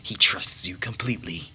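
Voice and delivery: hushed, scheming whisper; sinister whisper. Acted. A schemer gloats over the maid in a whisper. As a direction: whisper it like a plotting villain.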